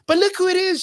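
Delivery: very high teasing surprise voice; mock sing-song reveal. Fan mimicking her Grogu reveal, very high sing-song tease. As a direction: tease it out like unveiling a present. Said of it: "all of a sudden she's like".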